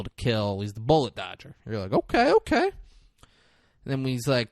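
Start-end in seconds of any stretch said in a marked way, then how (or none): 1.9-2.7 very high-pitched okay; acted bemused viewer; dubious acceptance. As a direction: go along with it, voice way up. Imitates viewer's doubtful reaction.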